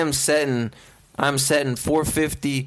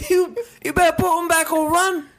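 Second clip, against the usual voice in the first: mock British grocer, pitched well up and louder; British shopkeeper; 11 semitones higher, 5 dB louder than usual. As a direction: threaten the shoplifter in a goofy British voice. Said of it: "a British man that works at a grocery store".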